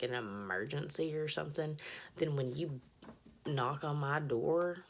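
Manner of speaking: ordinary talk, nothing marked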